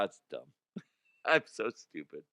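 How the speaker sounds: ordinary talk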